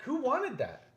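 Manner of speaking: high-pitched, exasperated disbelief building to a yelp; incredulous outburst. Real, not acted. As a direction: demand it in rising, squealing outrage. Mock outrage at announced match.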